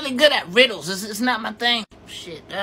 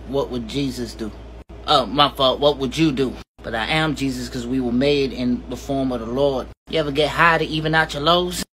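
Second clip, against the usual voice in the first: embarrassing dad; 9 semitones lower than usual. Acted. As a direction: deliver nonsense riddles in a deep droning voice. Deadpan absurd riddles, low voice.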